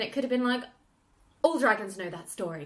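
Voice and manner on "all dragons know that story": low-key, sober delivery; serious. Shown as a demonstration. The dragon's line as it could have been, serious. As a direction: say it straight and grave. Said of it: "it could have been serious".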